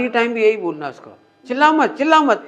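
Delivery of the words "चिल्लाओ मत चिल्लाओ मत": a sharp, higher, louder "चिल्लाओ मत, चिल्लाओ मत"; the wrong way to calm someone; wrong way, scolding. Shown as a demonstration. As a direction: snap "चिल्लाओ मत" at the child, a step up in pitch. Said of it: "ऐसे नहीं बोलो".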